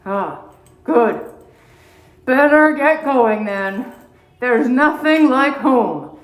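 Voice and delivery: put-on slow, drowsy old bear voice. Old bear heading home to his den, slow and content. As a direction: amble through it like a tired old bear.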